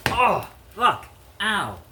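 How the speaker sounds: sudden high, loud yelp of pain; pained outburst. Real, not acted. Cooking, hit by a sharp pain, yelps out loud. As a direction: cry out at a sharp jolt of pain. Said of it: "I just got one of those weird pains".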